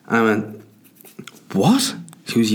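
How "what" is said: shocked what. Retold or imitated. Wife accuses him of procrastinating.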